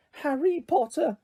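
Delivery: high, slow house-elf voice; a squeaky little elf greeting his hero; high-pitched elf. Acted. Elf greets Harry in a high squeaky voice. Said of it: "in a high-pitched voice".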